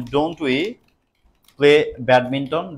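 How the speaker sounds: slow, drawn-out dictation. Shown as a demonstration. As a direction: dictate it word by word to class. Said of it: "teacher dictates slowly". (ধীরে ধীরে বলা)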